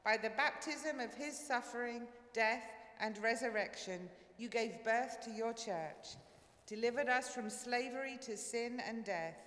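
normal talking voice

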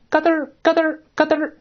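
high, repeated little clicks; imitated clicking. Shown as a demonstration. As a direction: mimic a clicking noise in short bursts. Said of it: "咱们来看看这个发出". (模拟咯噔儿的响声)

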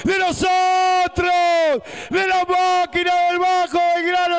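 high, loud goal call; goal scream. Real, not acted. Second goal celebration.

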